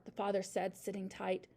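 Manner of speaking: usual speaking voice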